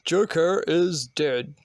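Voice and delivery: slow, drawn-out comic-book news headline; mock news announcer. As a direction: announce it word by word, very slowly. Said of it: "Bruce Wayne watches the news".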